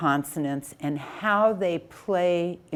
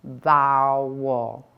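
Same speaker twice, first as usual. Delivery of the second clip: "vowel" drawn out slowly in two syllables; stretched out; 6 semitones lower than usual. Shown as a demonstration. Teacher sounding out a word for syllables, stretched out. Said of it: "do the syllables for the word".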